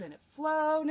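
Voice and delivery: excited. A narrator's reading. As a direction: lift it up with glee. Repeated line, building delivery.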